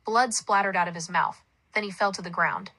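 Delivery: ordinary delivery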